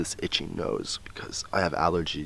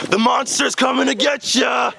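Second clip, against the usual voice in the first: high shrieking playful monster; 4 dB louder than usual. Pretend. Playful scare, monster coming.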